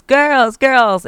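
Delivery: loud, high call of the daughters' names, ignored; mom yelling names. Retold or imitated. Mom calling her daughters, loud and high. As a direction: mom shouting for her girls from across the house. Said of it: "I'm yelling their names".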